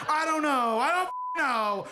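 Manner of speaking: high, loud protest; hammed-up exasperation, high and stretched; exasperated outburst. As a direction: wail it in mock helplessness. Protests having to pick a grade.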